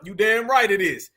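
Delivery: ordinary talk, nothing marked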